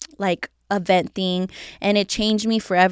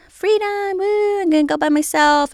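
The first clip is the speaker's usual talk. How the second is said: high cheer of freedom; celebrating freedom; 10 semitones higher than usual. Retold or imitated. Newly single and celebrating, a high excited cheer. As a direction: whoop it out, pitched way up. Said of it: "So I'm over here, like".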